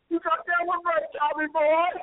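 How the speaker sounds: high-pitched mock holler; retold yell. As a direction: re-enact yelling at a passer-by. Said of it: "I yell right at him".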